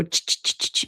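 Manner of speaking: sharp, voiceless "ch" bird clicks; imitated bird chatter. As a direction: imitate a bird's repeated chattering. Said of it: "sit there and go".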